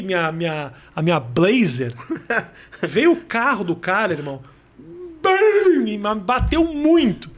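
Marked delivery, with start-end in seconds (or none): 5.2-5.9 high, sudden crash noise; imitated crash. Storyteller imitating a car hitting his Blazer, crash noise. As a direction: burst out with the bang of a collision.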